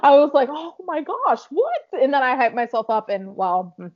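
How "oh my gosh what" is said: high mock-shocked reaction, leaping up at the end; squeaked, acted-out disbelief; retold surprise. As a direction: act out astonishment, pitch shooting up. Retelling own surprised reaction.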